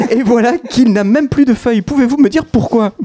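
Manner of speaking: high, pleading guard voice; second sentinel begging for an answer, pitched high. Acted. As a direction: ask it up high like an anxious guard.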